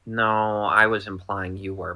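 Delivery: normal talking voice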